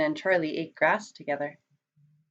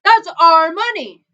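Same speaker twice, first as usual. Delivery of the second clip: high, loud protest; indignant yell with raised pitch; shouting; 10 semitones higher, 11 dB louder than usual. A narrator's reading. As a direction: shout it in outrage. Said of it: "shouted Bert".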